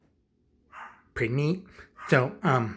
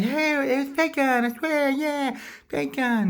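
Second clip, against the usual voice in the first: squeaky-high pleading clown; high-pitched protest; 3 dB louder than usual. Acted. Clown making excuses to his boss, high pleading voice.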